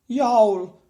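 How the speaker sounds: sudden high yelp of pain; loud pained yowl. A narrator's reading. Giant biting a frying pan yowls in pain. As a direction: yell out as the teeth break. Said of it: "shouted Cuchulainn".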